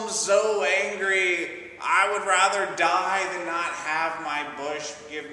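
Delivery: high, loud, slow whiny complaint; whining anger. Acted. Jonah wakes to find bush gone, loud slow whine. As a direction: drag out the sulk like a teen. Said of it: "He's just whining and complaining".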